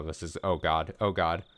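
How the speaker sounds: ordinary delivery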